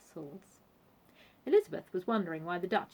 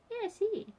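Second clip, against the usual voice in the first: high-pitched, polite hostess; reply politely in a high voice; genteel Vanessa; 13 semitones higher than usual. A narrator's reading.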